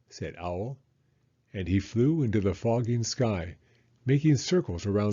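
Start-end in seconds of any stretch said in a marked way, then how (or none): none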